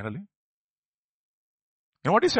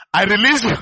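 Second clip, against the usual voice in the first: a judge's loud, high verdict; loud pronouncement; 4 semitones higher, 9 dB louder than usual. Retold or imitated. Judge in the film releasing Gandhi, loud and high. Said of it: "then he says".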